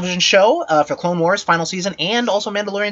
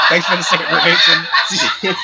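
laughing. Real, not acted. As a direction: stretch it out while laughing. Laughing drawn-out thanks.

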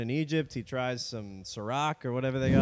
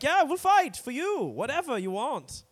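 very high, eager mock German; Germany personified; 14 semitones higher, 5 dB louder than usual. Germany offering to fight for allies, comic German accent. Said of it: "They're like".

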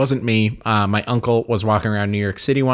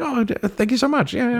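put-on flattered old actor; 13 semitones higher than usual. Ian Holm thanking the fan, mock humble. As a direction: play the modest star, lightly.

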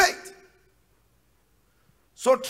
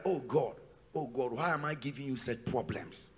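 much softer, lower, self-pitying moan; 9 semitones lower, 14 dB quieter than usual. Retold or imitated. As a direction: mimic a defeated believer under his breath. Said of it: "it's like".

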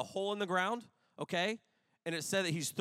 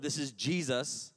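normal talking voice

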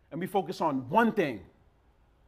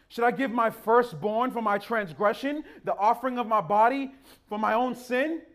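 higher-pitched pointed question; sarcastic; 6 semitones higher, 4 dB louder than usual. Acted. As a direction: push the offer to an absurd extreme. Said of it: "Y'all hear the sarcasm now".

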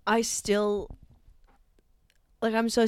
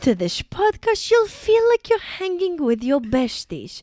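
put-on high, loud silly old-person voice; shrieky comic elderly voice, pitched up; 6 semitones higher, 7 dB louder than usual. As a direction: play a hammy old dear reading aloud. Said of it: "do a show as these old people".

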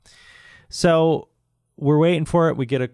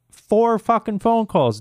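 ordinary delivery

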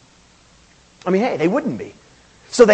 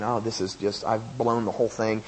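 dropped to a soft, deflated murmur; a soft aside to himself; quiet inner thought; 9 semitones lower, 8 dB quieter than usual. Retold or imitated. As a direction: say it to yourself, defeated. Preacher's inner doubt mid-sermon.

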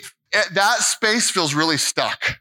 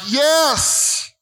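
joyful yes; 9 semitones higher than usual. Retold or imitated. Preacher reliving saying yes to God in his spirit, exultant. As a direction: burst out a full yes. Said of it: "really in my spirit was like".

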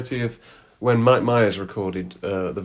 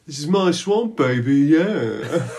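high, playful put-on voice; catchphrase parody; 3 semitones higher, 4 dB louder, slower than usual. Co-host twisting the ogre's swamp line, hammed-up high voice. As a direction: go higher and drag out the final yeah.

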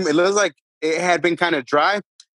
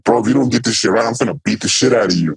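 threatening teammate; 7 semitones lower, faster than usual. Retold or imitated. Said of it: "one of the players came and was like".

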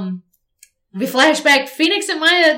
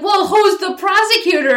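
high mock question; 8 semitones higher, 4 dB louder than usual. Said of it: "And then he's like".

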